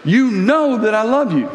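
frustrated. Retold or imitated. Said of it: "getting a little bit frustrated".

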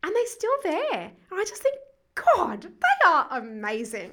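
tearful admiration, breaking and slow. Real, not acted.

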